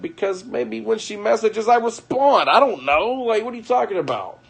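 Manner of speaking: high, incredulous retelling of his own comeback; hammed-up disbelief at wife's accusation; exasperated retort. Retelling a fight with his wife, incredulous and high.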